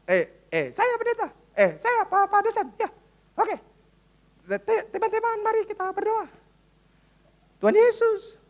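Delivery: lightly put-on NTT accent leading a prayer. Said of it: "lucu sekali aksennya".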